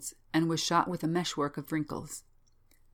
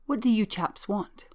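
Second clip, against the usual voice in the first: a touch of alarm, raised pitch; startled demand; 5 semitones higher, 4 dB louder than usual. Acted. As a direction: challenge the intruders, pitched up a little. Startled man confronts his visitors.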